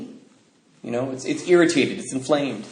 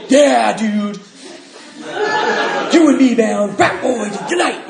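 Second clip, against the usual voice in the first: mock party shout; 10 semitones higher, 5 dB louder, slower than usual. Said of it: "And you just be like".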